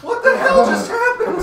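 high, loud, laughing disbelief; shocked. Pretend. Mock shock after the edit.

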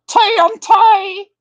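high, drawn-out ring announcer call; hammed-up big announcement of the name; announcer shout. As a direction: bellow the name like a wrestler's intro. Streamer greets a viewer by name.

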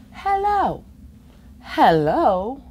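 plain reading voice

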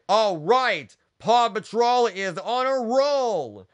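high hero call, last word stretched out; rousing shout. A narrator's reading. Ryder rallies the Mighty Pups for action, shouting. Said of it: "Ryder shouted to the Mighty Pups".

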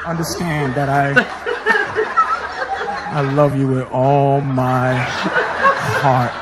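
low, slow heartfelt farewell; hammed-up slow farewell to mother; slow tender goodbye. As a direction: say goodbye like it's the last time.

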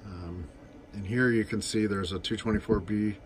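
ordinary talk, nothing marked

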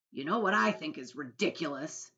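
loud, mocking retort; cheeky centipede. A narrator's reading. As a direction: throw the word back with a jeer. Plays jeering centipede.

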